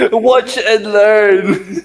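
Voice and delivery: cocky and laughing; laughing boast. Real, not acted. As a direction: brag while cracking up. Boasting before gambling roll.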